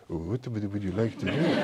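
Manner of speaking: mock French waiter, softly spoken; French waiter accent. Waiter asks a diner what to drink, in a mock French accent. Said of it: "I won't do the accent again".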